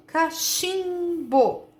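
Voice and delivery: CACHIMBO slow and high, nasal "chim" held long; som nasal demonstrado. Shown as a demonstration. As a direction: stretch the "chim" of "cachimbo" before "bo". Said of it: "E por M". ("cachimbo" bem devagar, alongando o "chim" nasal)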